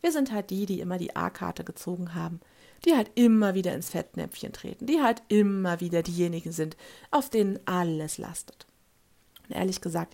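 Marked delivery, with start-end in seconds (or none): none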